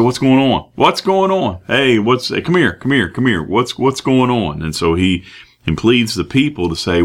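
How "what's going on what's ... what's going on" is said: calling out. Acted. Blind man calling to crowd.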